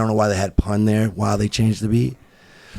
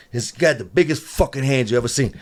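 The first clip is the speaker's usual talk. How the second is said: ordinary talk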